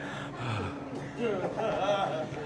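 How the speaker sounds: very soft winded exhale; out-of-breath sigh. Acted. Comic just standing there, out-of-breath sigh. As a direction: act winded from doing nothing. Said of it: "it's bad when you get out of breath".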